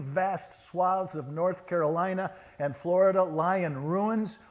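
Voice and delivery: high, insistent plea building to a loud cry; anguished protest. Acted. People challenging Jesus over war and hurricanes, anguished. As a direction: demand an answer, peaking on the last question.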